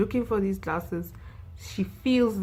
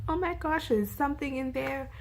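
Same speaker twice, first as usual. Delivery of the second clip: retold scream; 8 semitones higher than usual. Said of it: "she screams".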